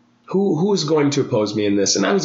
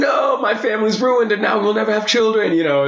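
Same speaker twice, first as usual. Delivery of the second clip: mock hysterical mom; 10 semitones higher than usual. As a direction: ham up a panicked mother. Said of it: "roller coaster of like".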